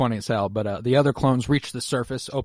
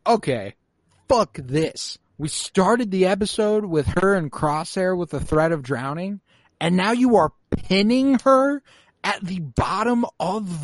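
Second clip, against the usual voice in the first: retold rant; 6 semitones higher, slower than usual. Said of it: "I was like".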